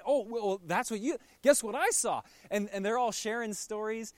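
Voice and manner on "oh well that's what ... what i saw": eager one-upping, higher; can't wait to top the last story; louder, eager brag; excited. Retold or imitated. Disciples one-upping each other's stories.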